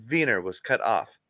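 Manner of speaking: ordinary delivery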